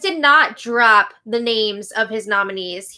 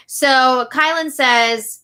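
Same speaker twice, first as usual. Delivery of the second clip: usual speaking voice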